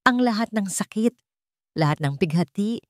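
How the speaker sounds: usual speaking voice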